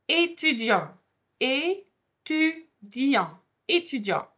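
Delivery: étudiant slowed down, each syllable clear; pronunciation drill. Shown as a demonstration. Teacher drills étudiant slowly for students to repeat. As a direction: model étudiant slowly for repetition. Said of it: "let's do a little bit of practice".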